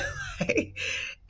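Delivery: a sudden high burst of laughter. Real, not acted. Mom laughing at her kids' question, high laugh.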